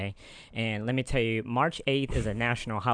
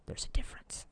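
whispering; 10 dB quieter, faster than usual. Real, not acted. Whispered aside after correction.

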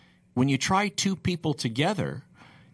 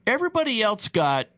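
indignant protest; 7 semitones higher than usual. Retold or imitated. Defendant protesting voiced long.

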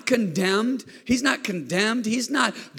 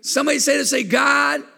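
raised, ringing preacher's voice, driving each line; full-force pulpit preaching; 4 semitones higher, 4 dB louder than usual. Acted. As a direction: lift the room with it. Preaching climax, shouted.

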